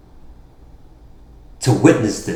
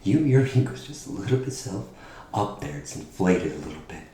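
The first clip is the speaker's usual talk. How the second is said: quiet menacing promo; 4 semitones lower, 13 dB quieter than usual. Acted. Hushed taunt in promo.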